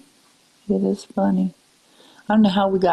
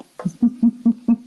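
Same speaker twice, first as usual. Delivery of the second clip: rippling run of chuckles; real chuckle; 3 semitones higher than usual. Real, not acted. Amused at being from further back, light chuckle. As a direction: laugh lightly at yourself. Laughs about being even older.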